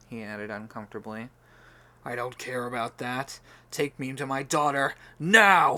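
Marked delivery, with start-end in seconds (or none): 2.0-5.8 furious demand. Acted. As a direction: slam the order down. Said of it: "he demanded".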